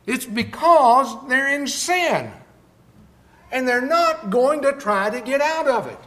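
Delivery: loud, high, driving sermon delivery; fiery pulpit preaching, building up; full-force preaching. Acted. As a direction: preach it hard to the whole room.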